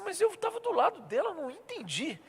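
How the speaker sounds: high, puzzled voice, quiet at the end; bewildered. Retold or imitated. Pastor retelling his confusion to the church, high and puzzled. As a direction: play yourself lost for words.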